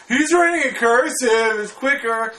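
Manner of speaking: high, drawn-out mock tattle; tattling classmate. Friend turning him in to the teacher, high tattling voice. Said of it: "he was like".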